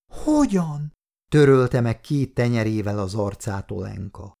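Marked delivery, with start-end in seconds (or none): 0.1-0.9 put-on questioning little girl. A narrator's reading. Tearful child asks old man.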